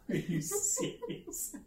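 stunned giggle of relief; high breathy laugh of disbelief. Retold or imitated. Learns the test trick, laughing.

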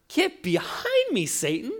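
side-eyed rebuke. Retold or imitated. Jesus rebuking Peter who stepped beside him, sharp and high. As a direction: snap it at him with a sideways glare. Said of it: "side-eyes him fully and says".

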